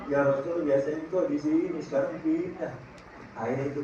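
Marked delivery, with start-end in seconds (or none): 0.1-2.7 low, soft, tearful voice of the palm tree; a quiet, plaintive palm tree lamenting; weeping palm tree. Acted. Palm tree complaining softly to the Prophet, tearful. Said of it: "pohon korma nangis".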